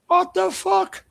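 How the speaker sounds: outraged exclamation. Real, not acted. Outraged rant about foreign aid.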